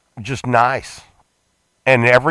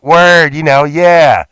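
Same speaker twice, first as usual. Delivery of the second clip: buddy voice; 4 semitones higher, 8 dB louder, slower than usual. Retold or imitated. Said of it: "he's like".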